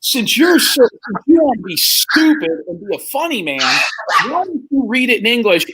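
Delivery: high, cutting teacher voice; mocking teacher. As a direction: sneer at the class clown. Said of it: "She goes".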